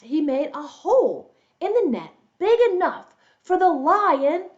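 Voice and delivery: ordinary delivery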